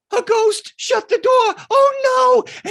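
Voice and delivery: very high, loud crying wail; panicked little kid. Retold or imitated. Little Marky fleeing ghost door, crying shriek. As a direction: shriek it like a terrified boy.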